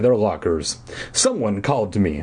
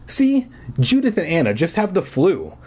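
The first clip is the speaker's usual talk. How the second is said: lightly voiced reassuring friend; 6 semitones higher than usual. Corey reassuring, voiced high.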